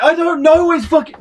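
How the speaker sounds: high, upset imitation; mock distraught. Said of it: "Full breakdown".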